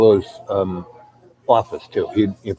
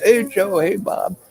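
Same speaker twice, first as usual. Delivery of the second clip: bright, high friendly hello; high cheery shout-out to friends; cheery greeting; 10 semitones higher, slower than usual. Retold or imitated. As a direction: call out to regulars with a lift. Angelo greeting regulars warmly.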